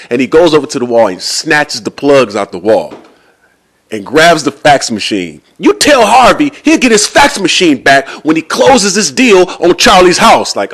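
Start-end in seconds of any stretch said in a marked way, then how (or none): 5.6-10.6 high, forceful acted ultimatum; blowing his top. Seller warning the receptionist over the deal, acted fury. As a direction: lay down the threat at raised pitch. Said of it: "blows his top".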